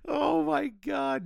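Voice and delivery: drawn out, high and laughing; slow, squealing laugh through the words; real amused disbelief, high and slow; laughing oh my God. Real, not acted. Reacting to the wallet scene.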